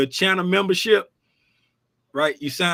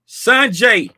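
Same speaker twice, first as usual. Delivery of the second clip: calling out; 4 semitones higher, 8 dB louder than usual. Real, not acted. Shouting out a member's name.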